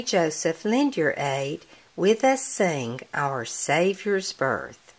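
ordinary delivery

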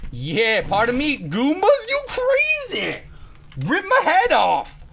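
loud, high, climbing to a drawn-out shout; frantic yelling. Real, not acted. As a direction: yell wildly at attacking enemies. Yelling at attacking Goombas.

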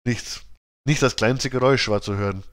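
usual speaking voice

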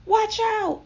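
very high, loud warning yell. A narrator's reading. Hippo warning tumbling animals, high yell. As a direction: yell an urgent alarm. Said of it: "yelled the hippo".

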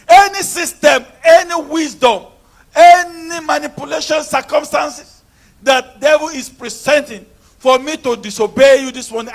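loud, ringing prayer hammered out line by line; preaching at full force. Real, not acted. Preacher leading the congregation in warfare prayer, full force. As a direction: pray it like a battle cry against the devil.

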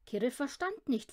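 usual speaking voice

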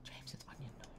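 soft whispered excitement; whispering. Real, not acted. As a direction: whisper it with glee. Whispers near the NPC.

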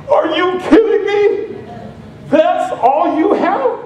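high, slow mock astonishment; God laughing at how much you really have; amused disbelief. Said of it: "God goes".

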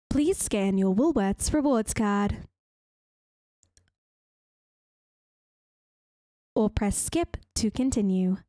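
put-on recorded-prompt delivery; self-checkout voice. Shown as a demonstration. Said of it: "take my self-checkout voice".

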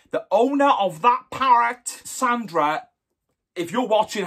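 usual speaking voice

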